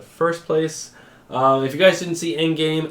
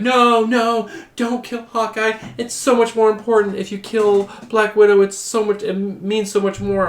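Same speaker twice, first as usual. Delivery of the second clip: mock pleading women; 8 semitones higher than usual. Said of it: "They're like".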